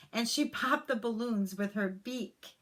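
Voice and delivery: ordinary delivery, nothing marked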